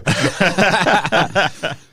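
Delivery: high, loud burst of laughter; real laughter. Real, not acted. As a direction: crack up loudly because it's true. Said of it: "he's laughing 'cause it's true".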